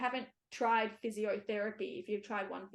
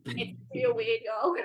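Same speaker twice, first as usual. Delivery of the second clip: laughing, high mock American accent; 9 semitones higher, 8 dB louder, slower than usual. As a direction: toss it off in a jokey fake American accent, giggling. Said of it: "I said that with an American accent".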